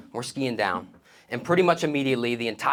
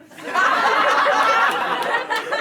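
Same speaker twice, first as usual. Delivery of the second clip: high-pitched loud cackle; high laugh; 5 dB louder than usual. Real, not acted. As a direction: burst out in a squeaky laugh. Laugh after pushing kid.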